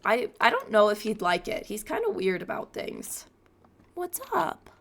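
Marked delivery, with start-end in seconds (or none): 3.9-4.7 high cooing greeting. Real, not acted. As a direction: coo hello to the cat. Cooing at her cat.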